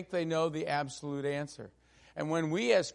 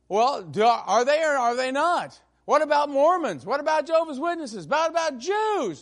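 hammered-up angry grilling; 9 semitones higher, 7 dB louder than usual. Retold or imitated. Senator shouting questions at candidate.

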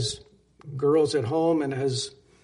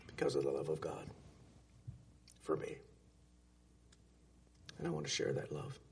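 much lower, softer, almost whispered; hushed tender answer; 12 semitones lower, 13 dB quieter, faster than usual. Retold or imitated. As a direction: let it fall to a hushed confession. Girl answers, everyone crying.

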